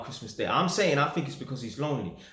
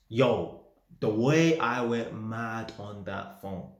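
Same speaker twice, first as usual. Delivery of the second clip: usual speaking voice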